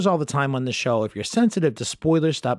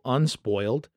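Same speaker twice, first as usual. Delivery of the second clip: ordinary talk, nothing marked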